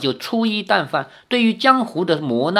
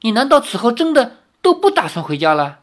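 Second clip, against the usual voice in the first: higher, startled asking; puzzled question; 8 semitones higher than usual. A narrator's reading. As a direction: ask in sudden puzzlement. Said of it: "奇怪地说". (惊讶地问)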